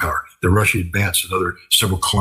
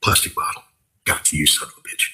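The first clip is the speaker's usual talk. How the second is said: lightly voiced operator, low and under the breath; a hint of a hushed, low aside on spotting the target; imitated drone operator; 5 semitones lower, 4 dB quieter than usual. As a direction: lightly act out a quiet private gotcha. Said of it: "And a Russian drone operator goes".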